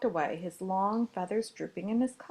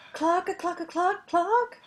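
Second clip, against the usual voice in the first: high clucks climbing upward; hen clucking; 10 semitones higher, 6 dB louder than usual. A narrator's reading. Hen attempting to crow, high clucking. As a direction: cluck higher and higher. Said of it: "But all she managed was".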